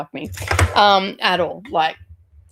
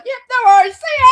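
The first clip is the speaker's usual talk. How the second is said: high, loud, laughing breezy goodbye; laughing mock-cheery exit; 14 semitones higher, 11 dB louder, faster than usual. Leaving the angry mother's house, high and laughing. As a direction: chirp it brightly while cracking up.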